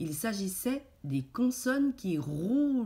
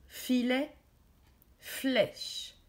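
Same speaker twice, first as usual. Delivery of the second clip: flèche said higher, fl drawn out; stressed fl; 6 semitones higher, much slower than usual. Shown as a demonstration. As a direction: bring out the fl in flèche. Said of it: "Dis si tu entends fl". (flèche, en faisant bien entendre le fl)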